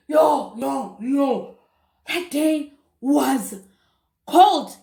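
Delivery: loud drawn-out yo and cold; emphatic yo. Real, not acted. Telling viewers how cold the night was, emphatic. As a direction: stretch it, that day was cold.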